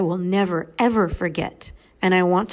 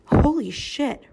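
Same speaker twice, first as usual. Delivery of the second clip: much higher amazed exclamation; stunned holy shit; 8 semitones higher, slower than usual. Real, not acted. Speaker marvels at the twelve-year-old's words, high amazed. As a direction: let the awe jump out high. Reacting to the girl's wisdom.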